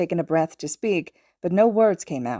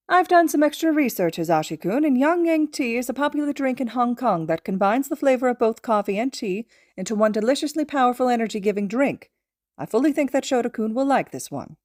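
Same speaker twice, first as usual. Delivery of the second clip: higher, confident little mentor voice; Nezu, prim and certain; 7 semitones higher than usual. Acted. Nezu insisting to his student about tea, higher and confident. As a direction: lecture him with cheerful certainty.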